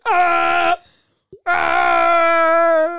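long, high held scream; attempted falling noise. Shown as a demonstration. Retrying the friend's falling noise, imitated scream. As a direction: hold a loud high yell, twice. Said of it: "Let me try that noise again".

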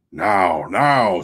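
ordinary delivery

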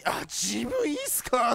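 slow, lazy excuse-making voice; lazy slacker voice. Pretend. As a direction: whine out an excuse to skip work. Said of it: "っつって".

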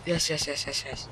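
hushed yes. Real, not acted. Quiet celebration.

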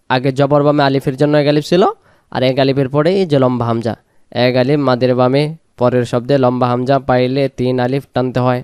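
ordinary talk

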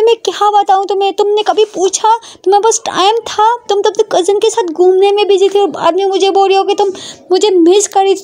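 high-pitched, accusing rant; reproachful Prita. Acted. Prita blames Ishani for busy cousin outings, reproachful.

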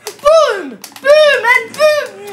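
triumphant shout. Real, not acted. Landing RPG hits, laughing.

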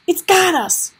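high, loud alarmed cry. A narrator's reading. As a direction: cry out in panic. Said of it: "Gregor cried out".

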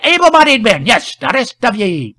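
high, loud rustic constable; officious loud echo. A narrator's reading. Constable repeats the officer's order, loud and officious.

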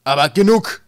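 very loud, high-pitched roar; bellowed. A narrator's reading. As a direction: bellow to shut down the discussion. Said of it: "brüllte Wolkenwolf".

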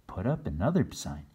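plain reading voice

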